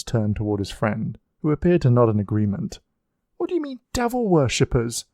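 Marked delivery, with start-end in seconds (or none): 3.4-5.0 voiced a young woman, pitched higher; a young woman calling out, demanding to know; young lady crying out. A narrator's reading. Young lady questioning the crowd, voiced higher. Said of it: "a young lady cried from behind".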